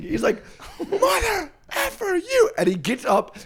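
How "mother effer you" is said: retelling his roommate's rage at the game, high, drawn-out mock rage; high, slow imitation of a raging gamer. Roommate cursing at his video game, high mock rage. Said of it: "he starts raging".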